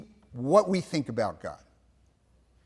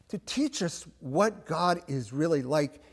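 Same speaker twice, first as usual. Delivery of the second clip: usual speaking voice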